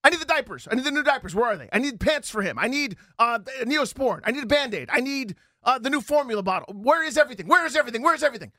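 hammed-up panicked dad who can't find anything; frantic panic. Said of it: "the angst and the anger and the panic".

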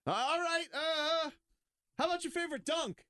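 high chirpy topic switch; mock handler voice. Handler jumping in to steer the talk, high and bright.

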